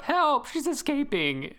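sing-song meme cry for help; lightly hammed-up meme panic; quoting a meme. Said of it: "the meme of".

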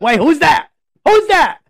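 loud, high, sharp "who's that"; mock alarm. Host pretending not to know the new guest's voice, mock alarm. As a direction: cry out at a mystery intruder. Mock-startled reaction to unknown voice.

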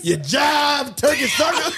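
loud, high laughing insult; laughing shout. Pretend. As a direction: yell the jab while cracking up.

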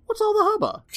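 very high, loud comic voice; put-on squawked question. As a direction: squawk it out in a silly falsetto. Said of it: "bunch of reporters asking".

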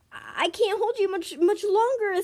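urgent strain. Acted. Addie holding a dangling friend, urgent strain. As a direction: push the pitch up as if losing grip.

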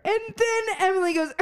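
normal talking voice

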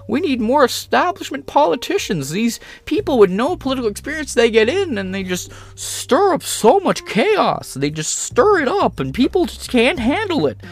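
high mocking whine of a pundit; mock Jordan Peterson. Mocking Peterson while ranting to viewers, high whiny voice. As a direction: lampoon him in a high nasal-free whine.

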